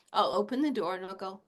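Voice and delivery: ordinary talk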